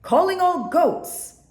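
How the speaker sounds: high, loud, slow call. A narrator's reading. Buffalo summoning goats, loud slow call. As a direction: call them in like a conductor. Conducting the animals.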